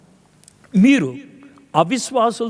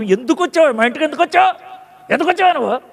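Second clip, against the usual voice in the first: higher, demanding and accusing; grieving mother accusing the prophet, higher and indignant; 7 semitones higher, faster than usual. Acted. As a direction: confront him indignantly. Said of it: "వేస్తున్న dialogue ఇది".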